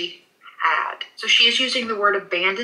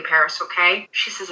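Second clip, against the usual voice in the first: ordinary talk, nothing marked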